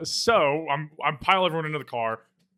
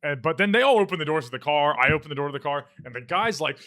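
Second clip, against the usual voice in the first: ordinary delivery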